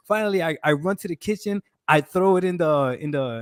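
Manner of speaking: usual speaking voice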